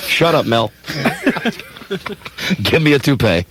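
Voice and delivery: ordinary delivery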